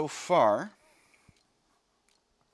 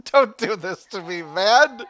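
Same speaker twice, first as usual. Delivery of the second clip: high, loud laughing plea; laughing protest; 9 semitones higher, 11 dB louder, slower than usual. Real, not acted. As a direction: laugh and beg the game. Reacting to soldier's dying line.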